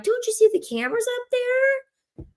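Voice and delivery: very high, squeaky mock-alarmed question. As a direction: shoot way up into a squeak. Repeats warning, pointing at camera.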